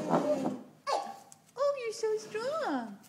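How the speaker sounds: high, loud gushing praise; excited praise. Real, not acted. Praising toddler.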